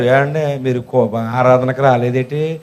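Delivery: low, slow pastor's question; pastor inquiring. Acted. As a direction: ask slowly and low, as a pastor would. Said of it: "pastor గారు అడుగుతారు". (Pastor నెమ్మదిగా అడిగే గొంతు)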